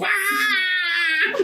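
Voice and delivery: very high, sustained shriek; mock girlish squeal of pain; little-girl scream. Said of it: "screaming like a little girl".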